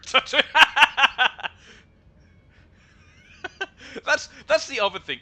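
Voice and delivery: loud, high burst of laughter; big laugh. Real, not acted. Laughing hard at a Pickle Rick tattoo, loud and high. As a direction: crack up at the joke.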